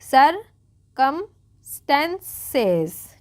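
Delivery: Circumstances very slow, part by part; broken into syllables. Shown as a demonstration. Teacher breaking a long word for students, very slowly. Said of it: "तोड़ के पढ़ेंगे".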